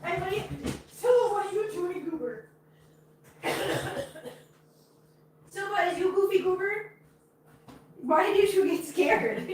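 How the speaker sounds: very high, cooing talk to a cat. Real, not acted. Cooing to her pet cat.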